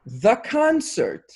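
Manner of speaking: THE CONCERT drawn out slowly, pitched high; weighty emphasis. Real, not acted. Daughter recalling a revered family story, weighty emphasis on THE CONCERT. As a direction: say "the concert" slow and high, like a legend.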